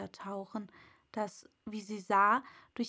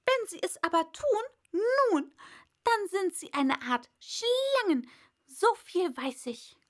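high, loud first word, then emphatic; sharp bird retort; disbelieving pigeon; 8 semitones higher, 5 dB louder than usual. A narrator's reading. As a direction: reject it as an indignant pigeon. Plays the pigeon.

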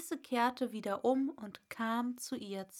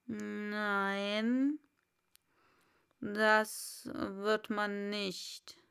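slow caterpillar; slow, dismissive caterpillar; Raupe; much slower than usual. Acted. Raupe voiced. (langsame Raupe)

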